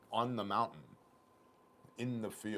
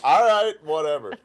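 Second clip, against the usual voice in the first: giving up, laughing; 9 semitones higher, 17 dB louder than usual. Retold or imitated. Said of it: "I'm like".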